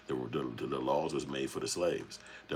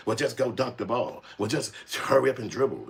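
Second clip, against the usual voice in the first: higher, mock-dismissive sneer; 6 semitones higher, 5 dB louder than usual. Streamer mocking people telling him to just play ball, sneering. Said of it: "it's a fucking problem".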